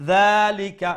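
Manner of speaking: loud "যালিকা" opening on a light tongue-tip th-sound, not z; touch the tongue tip lightly for "যালিকা", long first vowel; light dhal. Shown as a demonstration. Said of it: "make sure you pronounce the dal".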